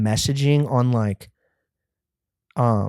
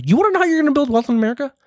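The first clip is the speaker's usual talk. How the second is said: much higher, fast, hyped-up guru pitch; mock hype pitch; 13 semitones higher, much faster than usual. To the audience, a mock guru asking if they want riches, high and fast. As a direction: sell the secret like a fast-talking guru.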